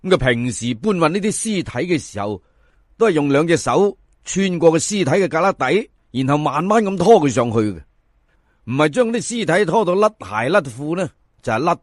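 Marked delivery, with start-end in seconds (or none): none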